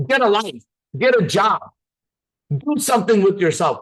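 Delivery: loud, high-pitched scolding; scornful passer-by. Acted. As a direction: tell off a beggar in passing. Imagined passers-by scorning a beggar.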